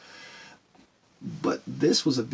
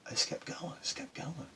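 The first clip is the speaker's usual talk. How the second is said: whispered; 6 semitones higher, 9 dB quieter than usual. Real, not acted. Quiet resolve after storm.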